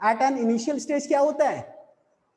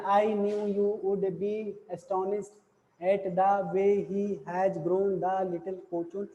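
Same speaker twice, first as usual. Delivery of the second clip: slow, level word-by-word reading; monotone demo reading, no rise or fall; flat tone; 4 semitones lower, 5 dB quieter, much slower than usual. Shown as a demonstration. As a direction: read every word on one unvarying note, slowly. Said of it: "इसको flat tones में एक बार पढ़ते हैं".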